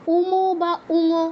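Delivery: উমো and উঙো drawn out for inspection; slow, deliberate উমো বা উঙো; slow demonstration. Shown as a demonstration. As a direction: stretch উমো and উঙো slowly so the mouth movement shows. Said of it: "খেয়াল করে দেখুন তো".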